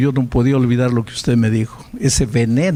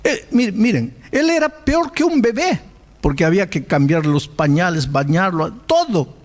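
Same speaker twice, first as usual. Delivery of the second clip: normal talking voice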